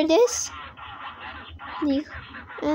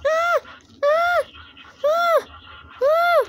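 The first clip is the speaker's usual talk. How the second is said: sudden high screams of fright; startled screams; 11 semitones higher, slower than usual. Real, not acted. Boy watching the alert video, screaming in fright. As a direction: scream at the jump scare, high.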